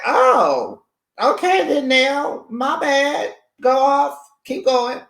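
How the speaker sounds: high, loud mock approval; sarcastically egging her on, way up high; sarcastic go-off. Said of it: "And I was like".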